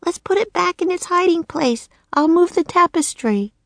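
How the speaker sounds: put-on high little-girl voice, croaked; a girl turned raven, croaking. Annie to Jack in the nursery, high croaking girl. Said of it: "croaked Annie".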